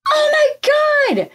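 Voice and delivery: high-pitched mock shriek of horror; retold shriek. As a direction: shriek it as if reliving the shock. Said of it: "And then I shrieked".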